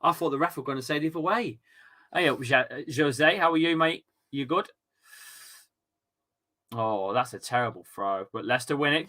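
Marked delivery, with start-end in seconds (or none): none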